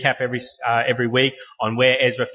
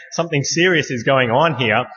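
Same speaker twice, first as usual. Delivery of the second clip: usual speaking voice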